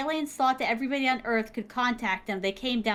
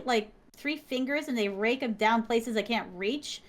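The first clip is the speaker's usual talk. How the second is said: ordinary talk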